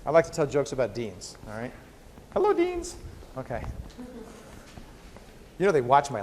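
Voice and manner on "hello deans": squeaky high hello, pitched way up; silly high voice. Pretend. Lecturer greets the deans watching, in a squeaky high voice. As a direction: pipe it up like a cartoon.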